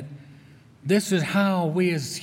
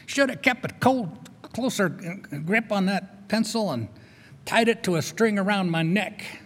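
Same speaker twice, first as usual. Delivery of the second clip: self-blaming outburst, punched then rising higher; mock anger; 4 semitones higher than usual. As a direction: act out exasperation at losing it. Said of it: "there's anger".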